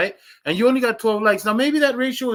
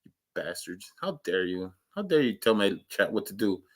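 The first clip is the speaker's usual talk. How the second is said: low, much softer mock grumble; mock scolding; 9 semitones lower, 8 dB quieter than usual. Teasing viewers, low mock scolding. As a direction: pretend to be offended, hushed.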